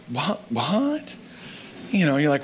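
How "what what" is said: stunned disbelief. Retold or imitated. Parent stunned by offer of help.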